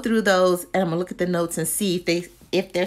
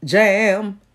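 ordinary talk

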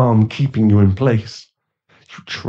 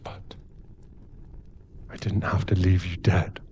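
hushed confession; 4 dB quieter than usual. Acted. Guilty confession to turned victim.

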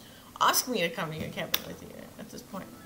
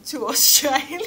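laughing; 11 semitones higher, 12 dB louder than usual. Real, not acted. Game player amused at own slipping accent, laughing. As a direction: burst out loud and higher, laughing.

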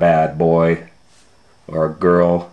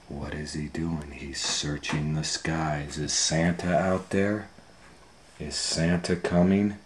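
hushed storytelling; 5 semitones lower, 12 dB quieter than usual. A narrator's reading. Bedtime hush.

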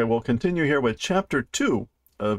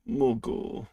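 usual speaking voice

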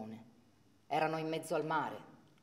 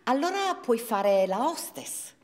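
coaxing; 7 semitones higher, 8 dB louder, slower than usual. Acted. Parent suggesting alternatives.

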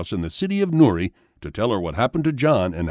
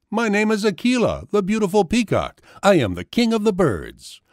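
higher-pitched voice for a boastful peacock; lightly voiced peacock king, pitched up; proud peacock; 10 semitones higher than usual. A narrator's reading. Peacock introduces himself, boastful.

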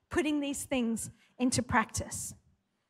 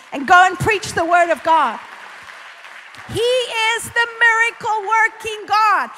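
loud, high, slow proclamation; preaching at full force; 4 semitones higher, 9 dB louder, slower than usual. Acted. Preacher declaring God's power to the congregation, loud. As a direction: build to a rousing sermon peak.

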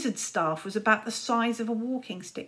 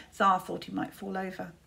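usual speaking voice

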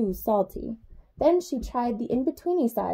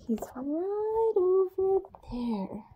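slow, high whisper; secret whisper; 8 semitones higher, 5 dB quieter, slower than usual. A narrator's reading. Narrator whispering to children where Nibbles hides. Pointing out hidden monster.

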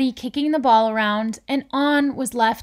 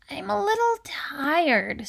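lightly voiced sleepy storybook figure; tired Anger; 6 semitones higher than usual. A narrator's reading. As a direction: let the energy drain away.

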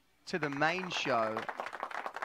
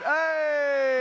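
cheering shout; 10 semitones higher, 7 dB louder than usual. Real, not acted. Cheering at the main show.

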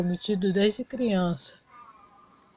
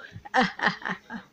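riso curto de vitória; riso debochado; 4 dB quieter than usual. Acted. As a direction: ri da empregada humilhada. Riso de vitória após humilhar. (riso debochado de quem venceu)

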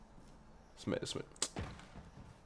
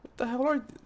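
startled; 3 dB louder than usual. Real, not acted. Startled by horror scene.

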